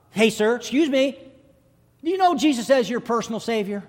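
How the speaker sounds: put-on high, loud boy's voice; insistent boy. Boy asks seatmate about Jesus, insistent and loud. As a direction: press the question, high and loud.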